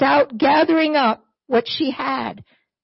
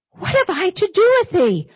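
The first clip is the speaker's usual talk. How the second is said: high, pleading cry of a grieving mother; anguished widow; 7 semitones higher than usual. Acted. Grieving widow challenges Elijah over her dead son, high and pleading. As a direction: voice the widow crying out at the prophet.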